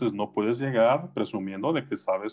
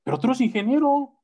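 incredulous relative; 10 semitones higher than usual. Retold or imitated. Relative questioning Pablo's job, high-pitched and incredulous. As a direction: voice a baffled aunt, much higher.

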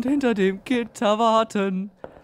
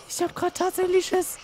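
high, squeaky admission of fear; real fright in a high voice; scared; 9 semitones higher than usual. Real, not acted.

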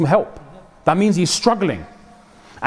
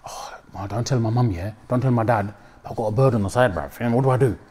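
hushed confession; 5 semitones lower, 4 dB quieter than usual. Retold or imitated. Youngster confessing secretly.

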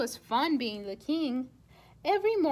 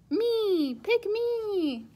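put-on kids shouting; 6 semitones higher, much slower than usual.

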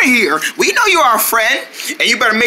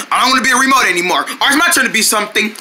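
ordinary talk

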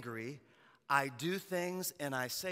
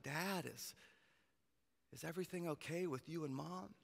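put-on soft, hushed child's question; teary daughter; 13 dB quieter than usual. Daughter asking her dad about the fight, softly. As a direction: ask it gently, close to tears.

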